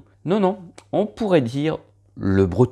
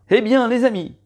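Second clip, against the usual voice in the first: excited call-out; 10 semitones higher, 4 dB louder, faster than usual. Real, not acted. High loud address to audience.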